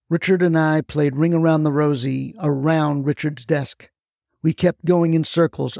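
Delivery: ordinary delivery, nothing marked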